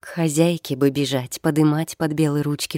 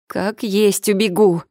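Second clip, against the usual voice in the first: higher, drawn-out wail; 5 semitones higher, 4 dB louder than usual. A narrator's reading. Young wife lamenting to her mother, wailing. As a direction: wail it slowly and insistently. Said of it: "запричитала Элька".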